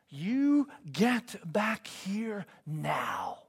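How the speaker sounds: stretched-out, half-volume retold scream. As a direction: imitate an angry dad's scream, held back. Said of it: "I just screamed two sentences".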